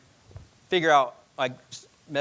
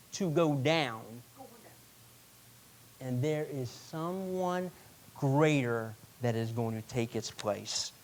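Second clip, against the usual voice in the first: slow, weighty proclamation with long pauses; slow prophetic declaration; slower than usual. Retold or imitated. Jesus to the crowd at the temple, slow solemn proclamation. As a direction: lay out each phrase like a verdict. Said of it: "he was saying".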